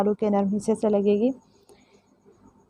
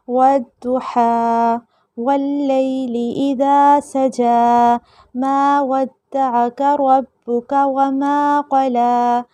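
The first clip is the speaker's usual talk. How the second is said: melodic tajweed recitation, a bit higher; Quran recitation; 4 semitones higher, 4 dB louder, much slower than usual. Shown as a demonstration. Teacher reciting the full surah to close the lesson, melodic chant. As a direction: recite the whole surah smoothly. Recites whole passage through.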